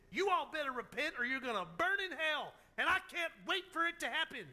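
loud, high-pitched angry threat, acted out; super mad. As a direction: play a furious prophet telling them off. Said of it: "super mad that he's been in a fish".

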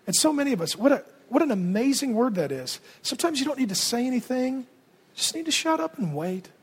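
normal talking voice

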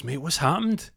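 high loud alarmed question; McNulty asking Amjad what happened, high and loud; high loud worry. Retold or imitated. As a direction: ask in alarm.

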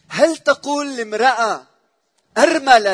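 a preacher's loud rhetorical question; rising indignant challenge. Acted. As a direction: demand it of the crowd.

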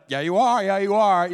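put-on loud, high childish taunt; teasing kids. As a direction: chant it back at mom, loud and high. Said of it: "And we're like".

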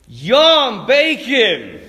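loud, hammed-up yum over bacon; a mocking pretend treat. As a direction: fake relish it loudly. Tempters mock pretending.

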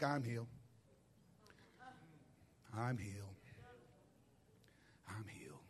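very low, barely audible murmur, imitating lost voice; voiceless whisper. Woman without a voice tries declaring her healing, whispering. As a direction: fade away to almost no voice. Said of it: "able to speak above a whisper".

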